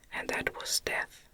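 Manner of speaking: usual speaking voice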